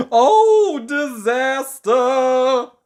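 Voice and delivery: very high, loud drawn-out wail; terrified scream. Acted. Mister Jelly seeing the tramp's face, screaming. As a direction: wail it in horror. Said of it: "he screamed".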